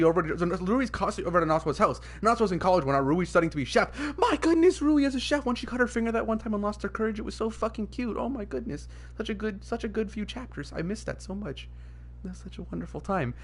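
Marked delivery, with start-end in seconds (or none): 4.2-5.4 gushing. Real, not acted. Gushing over favorite character. 5.4-13.3 soft, wistful gushing; fond reminiscing. Real, not acted. Fan remembering a good few chapters, fondly wistful.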